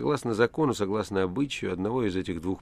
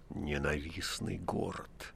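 slow, softer muttering under the breath; muttered; 3 semitones lower, 8 dB quieter, slower than usual. A narrator's reading. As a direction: grumble it to yourself. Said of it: "вдруг почему-то пробормотал прокуратор".

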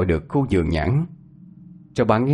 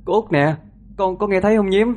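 calling out; 9 semitones higher than usual. Acted. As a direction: shout names into the night. Calling out in the orchard.

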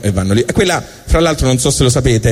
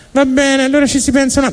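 high, hammed-up God giving in; a mock-grand God caving to the fish; 13 semitones higher than usual. Comic telling God to the animals, hammed-up high voice.

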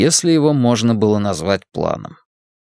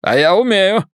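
put-on higher, smug Irish accent; 8 semitones higher, 4 dB louder than usual. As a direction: boast in a thick Irish accent. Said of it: "Ирландский акцент работал на всю катушку".

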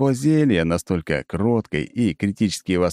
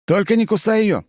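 urgent warning; 13 semitones higher, 3 dB louder than usual. A narrator's reading. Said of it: "предупреждаю я".

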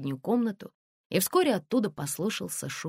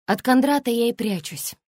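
ordinary delivery, nothing marked

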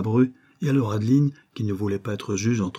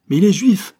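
loud, much higher indignant cry; outraged retort; 10 semitones higher, 9 dB louder than usual. A narrator's reading. Mother rebuking her calm son, loud outrage. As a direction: fling it back in outrage.

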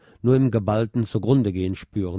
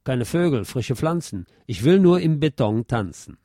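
recited lyrics; 4 semitones higher than usual. Acted. Narrator recites quoted song lyrics.

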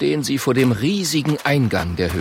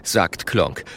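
ordinary delivery

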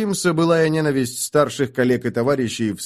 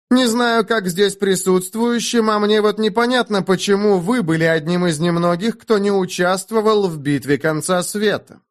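provocative; 8 semitones higher than usual. A narrator's reading. Young magister provokes rival.

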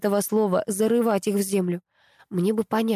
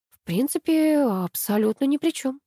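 higher-pitched, taken aback; flustered; 6 semitones higher than usual. A narrator's reading. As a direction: answer at a loss, pitch lifted. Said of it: "растерялась я".

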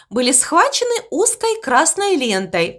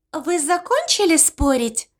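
squeaky voice; 4 semitones higher than usual. Acted. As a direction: squeak it out dreamily. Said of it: "спросила овечка". (писклявый голосок)

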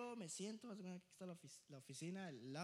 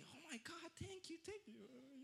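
tearful parent; 9 semitones higher, 5 dB quieter, slower than usual. Retold or imitated. Parents thanking in tears.

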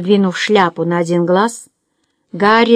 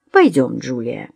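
low, slow, coaxing call; persuasive; 7 semitones lower than usual. A narrator's reading. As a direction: talk her down firmly. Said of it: "убедительно проговорил Гарри".

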